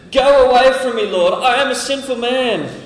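high, loud, pleading cry; loud, broken-hearted plea; desperate plea. Acted. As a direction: beg him to leave, overwhelmed. Peter falls at Jesus' knees.

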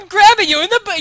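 high, loud indignant announcement. Shown as a demonstration. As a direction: declare it like you're offended. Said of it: "You're actually kind of indignant about it".

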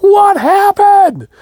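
very high, loud, drawn-out shout; exasperated yell. Retold or imitated. Dad shouts from the shower to his family, alarmed. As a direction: holler it in mock panic, stretching each word.

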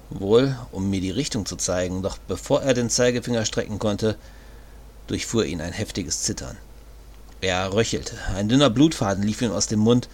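usual speaking voice

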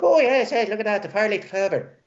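high-pitched cartoon Irish accent; Lucky Charms Irish. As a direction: overdo a stage-Irish leprechaun lilt. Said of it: "like Lucky Charms".

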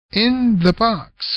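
slow deflection. Acted. Cliff deflecting.

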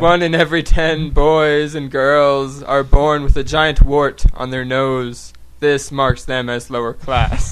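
slow, loud storyteller voice; mock storybook narration. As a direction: tell it like a solemn fable.

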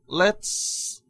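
ordinary talk

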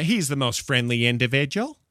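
individual as "in-duh-VIJ-ul", lifting at the end; imitating grandma's pronunciation. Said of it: "as my grandma would say".

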